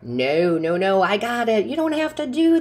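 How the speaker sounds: high-pitched imitated refusal, rising to a squeak; mock polite refusal. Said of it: "my initial reaction would be".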